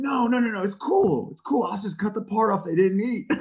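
high, hurried wheedling excuse; mock sheepish excuse. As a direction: squeak out a guilty self-justification. Defending taking leftover cheesecake.

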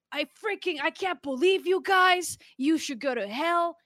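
mock an irate customer telling you off; angry customer. Imitating an angry customer yelling at staff, mock fury. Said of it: "a customer that's like".

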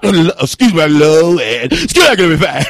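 loud, embarrassed catch-yourself; loud flustered excuse me; flustered loud aside. Real, not acted. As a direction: blurt it then excuse yourself.

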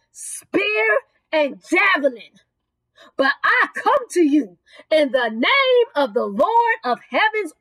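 emphatic preacher declaiming David's reply, phrase by phrase; fervent preaching. Acted. David answering the Philistine, fervent preaching. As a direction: preach it with swelling, whooping fervour.

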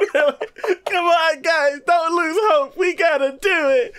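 very high, strained mock plea; squeaky over-the-top pep talk; desperate anime rally. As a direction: wail it like a cartoon hero begging the team. Voicing main character's desperate rally.

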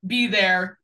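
ordinary talk

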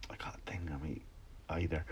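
breathless muttered whisper; whispered aside. Real, not acted. Podcaster whispering an aside to himself, losing his train of thought. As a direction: whisper it to yourself. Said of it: "whispered aside".

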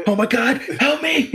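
high, panicked cry for help; panicked frantic pleading. Pretend. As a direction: plead in mock terror. Mocking a self-aware robot.

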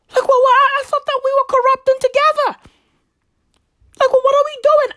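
falsetto, fast, playing a baffled official; high squeaky mock confusion. Mocking the Zambian minister in a high squeaky falsetto. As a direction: squeal it like a lost, betrayed crony. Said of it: "she's like".

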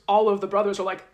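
usual speaking voice

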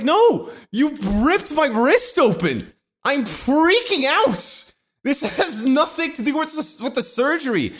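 high, stretched-out indignant protest; hammed-up retold outrage, leaning hard on the words; freaking out. Patient protesting to the nurse over his ripped wrist, freaking out. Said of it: "And I'm like".

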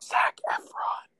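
slow, voiceless whisper. Real, not acted. Host suggesting a celebrity crush, whispering. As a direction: whisper the name slowly.

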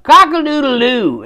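high, loud crowing yell; yelled rooster crow. Acted. Storyteller as a rooster crowing from the barn roof, yelling out. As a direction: belt a rooster's wake-up call. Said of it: "he would yell out".

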